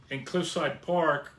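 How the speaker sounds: ordinary talk, nothing marked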